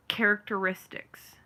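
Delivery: "characteristics" in full, all five syllables; correct pronunciation. Shown as a demonstration. Showing how the word should go, correct pronunciation. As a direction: say "characteristics" complete, with the -istics ending. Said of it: "certain words aren't pronounced right".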